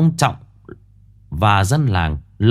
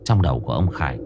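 ordinary delivery

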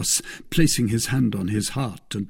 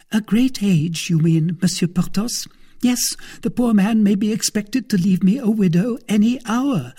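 high-pitched female character voice; hint meaningfully at coming widowhood; insinuating wife; 12 semitones higher, 5 dB louder than usual. Acted.

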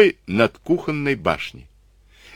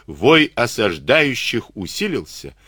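ordinary delivery, nothing marked

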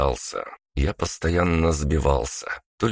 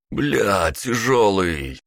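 strained groan under heavy weight; 5 semitones higher, 3 dB louder, much slower than usual. Acted. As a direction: groan it out, high and slow. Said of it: "простонал второй".

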